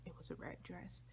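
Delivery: whispered secret. Real, not acted. Confiding the red dress to viewers in a whisper. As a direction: breathe it like a secret. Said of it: "confiding detail to viewers".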